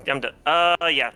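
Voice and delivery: ordinary talk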